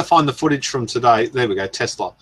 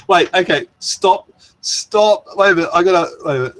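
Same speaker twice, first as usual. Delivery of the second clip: higher, louder flustered stop; flustered, laughing; 8 semitones higher, 4 dB louder than usual. Real, not acted. Streamer flustered by a chat joke, higher and louder. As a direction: caught off guard, scrambling to stop.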